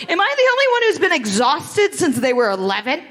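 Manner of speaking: ordinary talk, nothing marked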